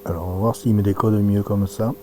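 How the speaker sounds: usual speaking voice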